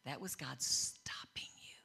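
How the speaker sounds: hushed counsel. Retold or imitated. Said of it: "he said".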